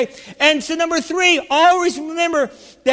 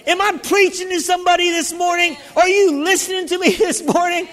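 full-force preaching; 3 semitones higher than usual. Acted. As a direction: drive every line home to the saints.

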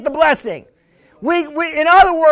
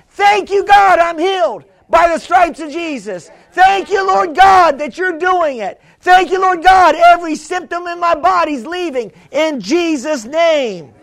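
crying out; 3 semitones higher than usual. Acted. Preacher models a believer thanking God for healing, crying out boldly. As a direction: proclaim it forcefully, pitch lifted on each thanks. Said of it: "We're not crying out".

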